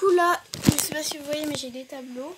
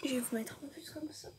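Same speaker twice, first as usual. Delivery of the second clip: whispering; 12 dB quieter, faster than usual. Real, not acted. Aside while adjusting camera.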